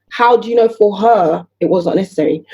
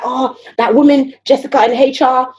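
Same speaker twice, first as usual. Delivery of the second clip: mock gossip; 4 semitones higher than usual. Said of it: "people be like".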